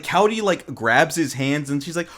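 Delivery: ordinary talk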